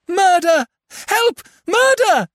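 high, agonized cries for help; agonized scream. Acted. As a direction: scream it in terror. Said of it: "high and agonized".

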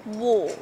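"wall" drawn out like English "waw"; wall-like war. Shown as a demonstration. Coach likening war to wall, rounded "wall". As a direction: model "wall" with a rounded vowel. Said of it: "almost as if you're saying wall".

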